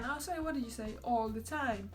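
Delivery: ordinary delivery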